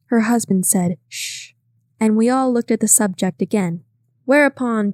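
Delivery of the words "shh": hushing. A narrator's reading. Hushing the room.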